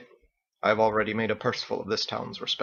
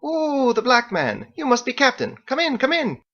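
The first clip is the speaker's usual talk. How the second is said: much higher, gushing hostess voice; welcoming Margot; 13 semitones higher, 4 dB louder than usual. Acted. Margot welcoming the Captain at the door, high and gushing. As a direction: greet him like a delighted hostess. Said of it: "Margot opened up, smiling".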